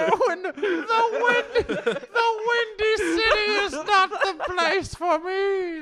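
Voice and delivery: high, slow, hammed-up villain rasp; belly begging for freedom like a cartoon villain; Skeletor-like stomach voice. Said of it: "Why does he sound like Skeletor?".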